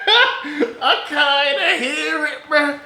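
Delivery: slow, high, loud and laughing; cracking up while saying it; laughing hard. Real, not acted. Repeats it, cracking up.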